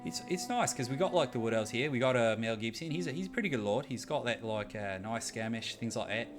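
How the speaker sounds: sustained put-on Australian accent; easygoing hammed-up Australian accent. Shown as a demonstration. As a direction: chat through the game in a corny Australian accent. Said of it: "when I talk like this".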